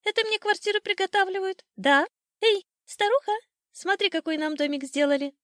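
high, loud, crowing bird voice; boastful old sparrow, delighted. Acted. Sparrow showing his wife the new house, high and excited. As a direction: pipe it up proudly and loudly.

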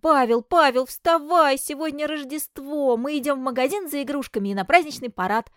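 loud, high, eager calling; excited wake-up call. Acted. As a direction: shake your brother awake, shouting. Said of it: "тормошил Клеон брата".

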